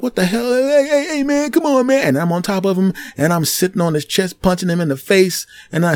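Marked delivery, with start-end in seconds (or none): 0.0-2.1 high pleading yelp; cornered bully whine; whining bully. Retold or imitated. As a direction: beg him to stop, voice up. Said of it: "he's like".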